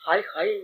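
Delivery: soft mock lament. Preacher sighs in lament.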